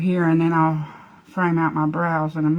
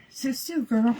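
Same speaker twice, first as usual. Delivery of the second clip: soft command to dog; 4 semitones higher, 7 dB quieter, slower than usual. Real, not acted. Soothing the dog quietly.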